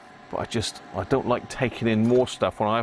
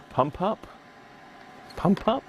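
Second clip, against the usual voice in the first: much higher each time, a silly squeaky query; mock squeak; 10 semitones higher than usual. Joking about his wife popping up, in a rising mock squeak. Playing on the wife's name.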